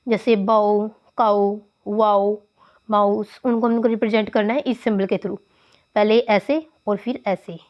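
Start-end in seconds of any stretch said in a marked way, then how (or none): 1.2-2.4 काउ and वाओ drawn out slowly, gliding the au; slow au demo. Shown as a demonstration. Teacher to students, slowly modelling the au glide. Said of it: "जो आउ की sound आती है".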